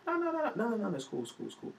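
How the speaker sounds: high, hurried no-no-no backing down; panicky placating. Retold or imitated. Comedian placates the threatening boyfriend, high and hurried. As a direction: defuse him fast, voice jumping up. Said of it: "So I'm like".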